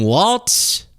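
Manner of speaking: "Waltz" with a T before the final S; mispronounced Walz. Shown as a demonstration. Host listing Democrats he wants, "Waltz" with a T. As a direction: say "Waltz" like the dance, questioning rise. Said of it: "got mad at me for saying Waltz".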